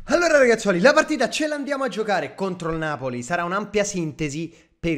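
loud hype intro. Real, not acted. Hypes team before match.

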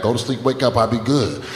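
usual speaking voice

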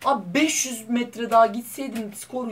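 high, anguished whine; whining lament. Real, not acted. Gamer whining to viewers about missing by a little. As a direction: moan over how close it was.

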